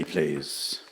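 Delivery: ordinary delivery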